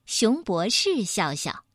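ordinary delivery

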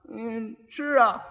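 slow, dull-witted reply; a hint of a dim groom, slowed; slow idiot. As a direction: drag it out like a simpleton. Idiot answers about dinner.

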